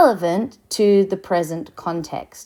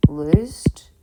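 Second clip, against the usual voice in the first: whispered word; 3 semitones lower than usual. Shown as a demonstration. Example verb in lecture.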